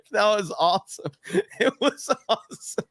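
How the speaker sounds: high voice breaking into laughter; laughing delight. Real, not acted. Fondly recalling the manager's outburst, cracking up. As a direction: squeeze the words out between laughs. Delighted at his own memory.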